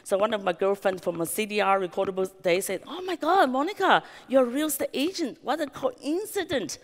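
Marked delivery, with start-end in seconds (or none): 2.9-6.8 put-on high, gushing girlfriend squeal; excited gush. Girlfriend at a dinner party, gushing to Monica in high excitement. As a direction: act out the friend's thrilled surprise.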